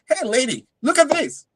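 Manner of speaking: high, thrilled call to his wife; excited call. Retold or imitated. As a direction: rush to show her, bursting with joy. Excitedly calling his wife over.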